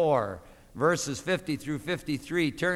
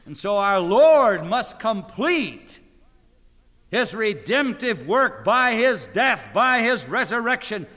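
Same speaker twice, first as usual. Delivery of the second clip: full-force preaching; 6 semitones higher, 8 dB louder than usual. Acted. Preacher driving home the ascension, full force. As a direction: hammer each phrase home.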